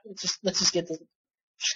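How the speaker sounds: sighing whisper. Real, not acted. Exhausted resignation after loss.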